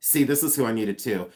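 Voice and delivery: ordinary talk, nothing marked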